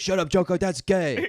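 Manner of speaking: put-on high, loud childish Batman. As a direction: yell it like a bratty kid. Batman voice in acted bit.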